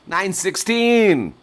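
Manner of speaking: high, loud, drawn-out shout with a laugh; gleeful cry. Acted. Streamer crowing the late hour to chat, laughing loud. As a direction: stretch it out like it's the best news ever.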